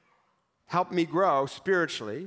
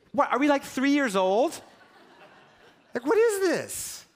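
higher, laughing in disbelief at a tantrum; 7 semitones higher than usual. Real, not acted. Mocks Jonah's tantrum, laughing.

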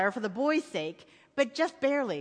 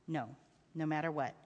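low, soft father's promise; reassuring father; 8 semitones lower, 8 dB quieter than usual. A narrator's reading. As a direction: confirm it quietly to a child.

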